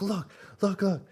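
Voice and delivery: high eager mock voice; imitating the cook. Vlogger imitating the cook showing photos, high eager voice. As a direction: urge them to look, high and eager. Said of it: "He's like".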